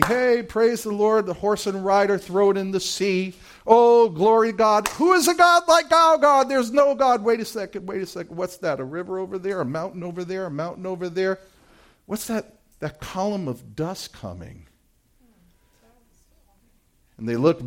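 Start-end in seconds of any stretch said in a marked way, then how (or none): 0.0-7.2 rising, jubilant shouts of praise; joyful praise. Acted. Freed Israelites celebrate their escape, jubilant. 7.2-14.7 softer, uneasy questions trailing off; hushed alarm. Acted. Israelites notice the army behind them, hushed and uneasy.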